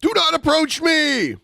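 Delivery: very high-pitched mock yell; mocking mimicry. Host parodies woman warning off man in parking lot, high mocking yell. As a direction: squeal it as a hammed-up imitation.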